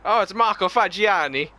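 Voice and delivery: put-on high-pitched Italian-accented welcome; hammed-up Italian accent. Streamer greeting a regular viewer, hammed-up Italian accent. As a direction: greet him with a playful Italian flourish. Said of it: "I'm gonna say that every time you tune in".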